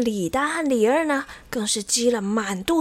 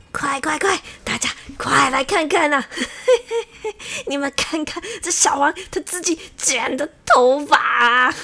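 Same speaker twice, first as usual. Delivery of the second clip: raised, jeering shout with laughter; gleeful calling out; 4 semitones higher, 5 dB louder than usual. A narrator's reading. Rival barbers call villagers to see the haircut, gleeful shouting. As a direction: summon a crowd to the spectacle. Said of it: "他们还向屋外大叫说".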